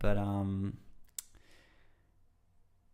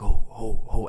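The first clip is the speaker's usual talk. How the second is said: loud, punchy mantra hoots; 2 semitones higher, 12 dB louder, slower than usual. Retold or imitated. Retelling going hard in mantra, loud eager chant. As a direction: chant short bursts with gusto. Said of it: "getting so into it".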